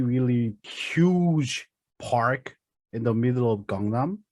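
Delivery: usual speaking voice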